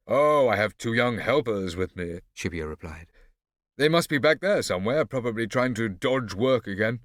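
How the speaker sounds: haughty, loud imitation of a father; condescending Dottore Massimo. A narrator's reading. Scipio imitating his father to Barbarossa, condescending. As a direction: look down on him loudly.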